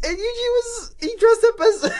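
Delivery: very high voice squeezed by laughter; cracking up. Real, not acted. Podcaster retelling a cartoon's Halloween episode, cracking up. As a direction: struggle to get the words out through giggles.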